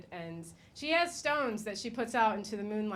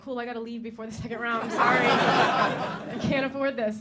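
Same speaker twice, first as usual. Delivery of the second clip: laughing; 2 semitones higher, 7 dB louder than usual. Retold or imitated. Stand-up acting out her reply.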